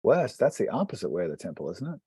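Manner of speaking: usual speaking voice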